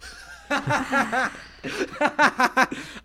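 high helpless giggling fit; long giggle. Real, not acted. GM cracks up.

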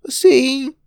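put-on high loud boy, near tears; shrill at him like a crying kid; shrill child. Boy sees his broken toy, high shrill voice. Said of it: "Stephen shrilled".

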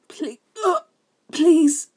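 high, loud sobbing please. Acted. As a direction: beg through sobs. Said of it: "Bord sobbed".

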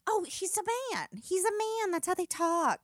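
high, dismissive mom voice; imitating mom. Mom dismissing the remarks to her daughter, high and airy. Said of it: "My mom".

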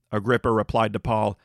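ordinary talk, nothing marked

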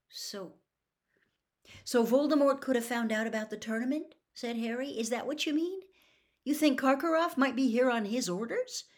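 put-on an agitated boy pressing his godfather; young Harry. Harry realises the danger.

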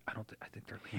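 scared whisper. Retold or imitated. Daughter whispering from under the bed. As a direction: whisper it from hiding. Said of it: "she's just like".

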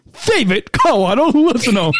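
silly high-voiced announcement; high-pitched voice. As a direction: leap up high for a playful flourish. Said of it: "I love how your voice changing, uh, changes".

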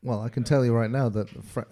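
usual speaking voice

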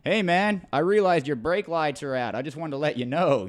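hammed-up American accent, pitched well up; The Rock, bad American accent. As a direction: do a loud, badly faked American. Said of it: "bad American accent".

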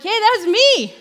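high, loud excited call; excited insistence. Retold or imitated. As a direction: pipe up loudly to be recognised. Healed man insisting to doubters.

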